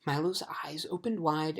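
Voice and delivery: usual speaking voice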